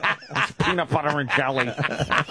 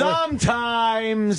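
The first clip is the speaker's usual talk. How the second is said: drawn-out mock; 8 semitones higher, much slower than usual. Stretching the Quint line.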